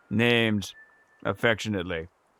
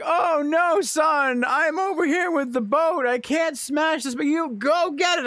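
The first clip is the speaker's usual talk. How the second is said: dad calling out; 9 semitones higher than usual. Retold or imitated.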